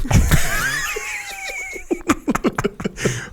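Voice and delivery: real howl of laughter; big burst of laughter. Real, not acted. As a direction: let a huge laugh burst out. Laughs at his own joke.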